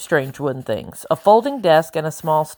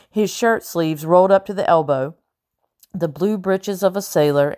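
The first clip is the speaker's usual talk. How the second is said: ordinary delivery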